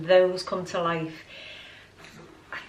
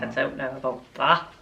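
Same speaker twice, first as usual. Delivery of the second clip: lightly voiced dog; 6 semitones lower than usual. Voicing the dog's reply.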